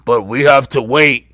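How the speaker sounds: low, lightly voiced mob boss; a hint of a New Jersey mobster; Tony Soprano impression. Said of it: "you sound like a Puerto Rican Tony Soprano".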